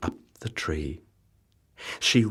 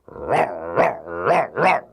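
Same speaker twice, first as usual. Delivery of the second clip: louder, rising howling row; dog barking; 5 dB louder, slower than usual. Acted. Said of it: "said Bottomley Potts".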